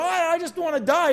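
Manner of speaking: whiny mock wail of giving up; mock despair. Preacher mimics a despairing believer, whiny. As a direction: act out a self-pitying groan. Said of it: "we're like".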